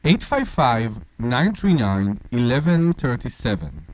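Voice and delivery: slow, low announcer voice; announcer read. Acted. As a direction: read the number like a radio ad. Slow radio phone number read.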